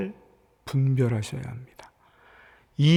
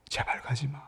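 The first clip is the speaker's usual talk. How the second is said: tearful whispered plea; pleading whisper; 2 semitones lower, 11 dB quieter than usual. Retold or imitated. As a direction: whisper it like a loved one begging through tears. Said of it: "눈물을 뚝뚝 흘리면서".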